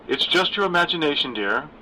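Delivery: mildly concerned, encouraging TV mom; coffee-ad mom. Acted. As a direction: reassure like the perfect commercial mother. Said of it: "sounding like Missus Olsen in the ancient coffee commercials".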